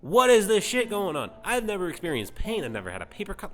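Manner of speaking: loud, high-pitched indignant rant; panicked outburst. Acted. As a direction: shout it in hammed-up alarm. Prince panics, demands to go home.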